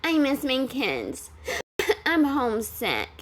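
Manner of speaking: soft, slow mock whimper with laughs breaking through; mimicking the mom's homesick whine. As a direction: make fun of her complaint, laughing. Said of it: "and you're over here".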